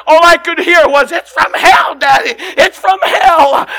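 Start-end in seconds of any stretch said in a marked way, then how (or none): 1.1-3.7 put-on a boy's answer cried out, high and loud; crying out. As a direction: cry it out, slow at the end, like a boy. Remembering childhood answer, preaching.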